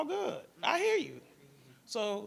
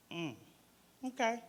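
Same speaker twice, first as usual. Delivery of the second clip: normal talking voice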